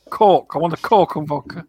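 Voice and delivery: Scottish "I want a cork and vodka" with "cork" for Coke; Scottish drinker at the bar, ordering; Scottish accent. Retold or imitated. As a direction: ask for a Coke and vodka like a Scot. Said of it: "that's what they sound like".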